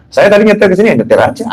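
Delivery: ordinary talk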